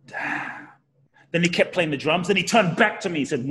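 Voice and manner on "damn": soft awed whisper; hushed stunned whisper; whispered realization. Retold or imitated. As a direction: barely voice it, floored. Inner reaction to the revelation.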